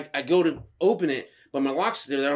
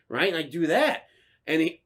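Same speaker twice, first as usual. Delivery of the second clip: ordinary delivery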